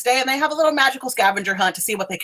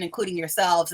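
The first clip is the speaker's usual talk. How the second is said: ordinary talk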